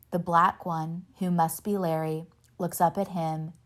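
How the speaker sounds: ordinary delivery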